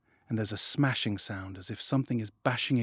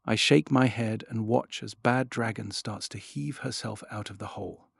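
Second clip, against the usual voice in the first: ordinary delivery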